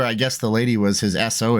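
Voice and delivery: normal talking voice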